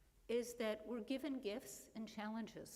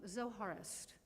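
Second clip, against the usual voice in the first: usual speaking voice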